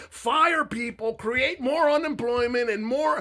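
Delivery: high, loud, almost shouting, voice cracking into a laugh-sob; furious rant. Real, not acted. Ranting at the president's supporters, high, loud and cracking. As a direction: rail at them with rising fury, voice breaking.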